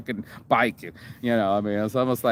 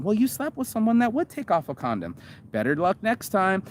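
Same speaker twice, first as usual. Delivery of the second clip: higher, mocking comeback; sarcastic retort; 9 semitones higher than usual. Answering the outraged woman with a higher mocking retort. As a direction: toss it off with a sneer.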